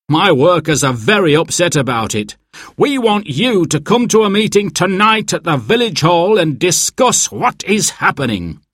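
angry complaint from an upset caller. A narrator's reading. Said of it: "sounded angry".

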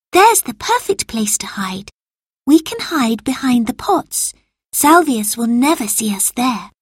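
lightly voiced keen child; eager child. A narrator's reading. Lucy showing friends the hiding place, eager.

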